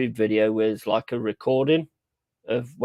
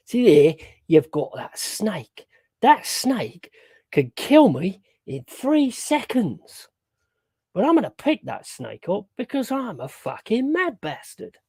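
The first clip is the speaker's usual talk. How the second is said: put-on Steve Irwin Aussie accent; 6 semitones higher than usual. Doing Steve Irwin in an Aussie accent for chat. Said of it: "I don't know if that was a good Aussie accent".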